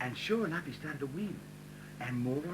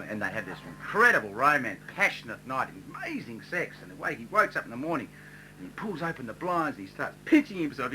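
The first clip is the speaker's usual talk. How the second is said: ordinary talk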